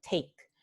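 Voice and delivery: "Take" with an aspirated t; aspirada. Shown as a demonstration. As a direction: puff of air on the T of "Take". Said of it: "con aspiración". ("Take" con la t aspirada)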